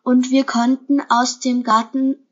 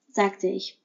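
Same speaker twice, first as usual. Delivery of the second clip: ordinary delivery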